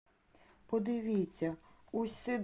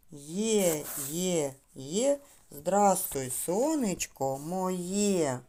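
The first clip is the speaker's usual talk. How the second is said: slow, rhythmic chant with stretched syllables; tongue-drill rhyme; 3 dB louder, much slower than usual. Shown as a demonstration. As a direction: lead a children's sound drill, stressing each beat. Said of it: "Давайте разом промовимо чистомовку". (ритмічна повільна чистомовка)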